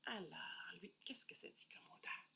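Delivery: soft breathy whisper. Real, not acted. Reviewer whispering to viewers before smelling the perfume. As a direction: hush it like a secret before sniffing.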